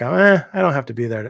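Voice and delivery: mock can't-be-bothered "eh"; imitating own lazy excuse; complacent shrug. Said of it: "I'm complacent, I guess, or lazy".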